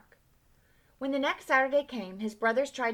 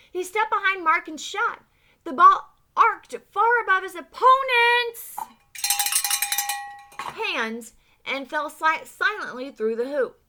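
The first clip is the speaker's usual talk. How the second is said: excited shout; 9 semitones higher, 10 dB louder than usual. A narrator's reading. Reader cheering the winning basket for the children, shouting. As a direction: pitch climbing much higher and far louder to a shout.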